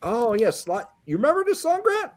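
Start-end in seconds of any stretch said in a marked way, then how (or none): none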